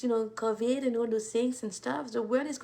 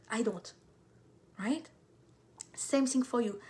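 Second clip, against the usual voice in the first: ordinary talk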